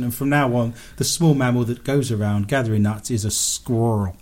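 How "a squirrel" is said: badly faked American "squirrel", middle letters swallowed; American accent. Shown as a demonstration. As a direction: attempt "squirrel" in an American accent, slurred into one syllable. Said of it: "say it with an American accent".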